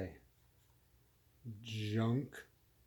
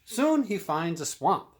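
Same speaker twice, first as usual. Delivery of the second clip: excited; 8 semitones higher, 8 dB louder than usual. A narrator's reading. Narrator excited at swamp.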